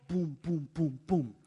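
knocking sounds. Acted. Imitating knocking on door.

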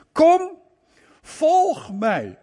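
calling out. Acted. Preacher voicing Jesus calling.

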